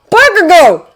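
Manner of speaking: high, loud strained call; hissed call. A narrator's reading. Kirishima waves at his classmate, hissed call. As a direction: urgently get his attention. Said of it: "he hisses, waving at him".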